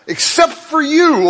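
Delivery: high, slow, drawn-out except for you; stressed flattery. Acted. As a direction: lay on the flattery, stretching you. Scheming advisers flatter the king.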